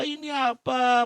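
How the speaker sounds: usual speaking voice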